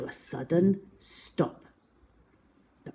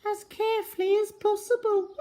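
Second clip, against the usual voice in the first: high and fast; squeaky rushed ending; quick high tag-on; much faster than usual. Acted. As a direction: zip through it high. Miss Quick finishing her words.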